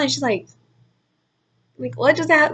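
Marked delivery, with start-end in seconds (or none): 2.0-2.5 high-pitched puzzled question; imitating a baffled stepmom; confused. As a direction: ask it high, as if lost. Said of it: "like, so confused".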